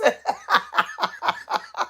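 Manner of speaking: airy, voiceless high laugh; breathless laugh. Real, not acted. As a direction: wheeze with laughter. Laughing at a viewer comment.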